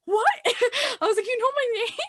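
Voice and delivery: high-pitched, giggly astonishment; amazed disbelief. Retold or imitated. Retold amazement at being recognised.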